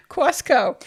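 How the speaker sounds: Costco said kʰwɑskoʊ, w after the k; Costco with caw. Shown as a demonstration. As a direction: let Costco slide into a caw sound. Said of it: "it turns into C A W".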